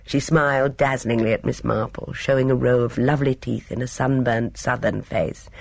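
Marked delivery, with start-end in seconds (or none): none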